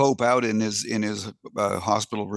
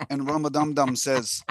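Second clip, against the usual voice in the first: ordinary talk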